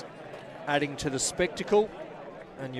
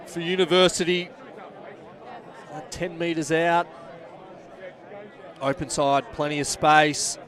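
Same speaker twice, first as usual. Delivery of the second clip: steady play-by-play. Acted. Live rugby match commentary.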